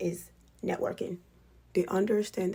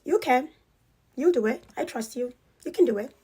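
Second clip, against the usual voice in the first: encouraging; 5 semitones higher, 5 dB louder than usual. Retold or imitated. Mom encourages child.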